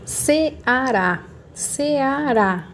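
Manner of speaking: Ceará slowly broken up, long final RÁ; syllable by syllable. Shown as a demonstration. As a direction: sound out Ceará beat by beat. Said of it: "Qual é a sílaba mais forte?".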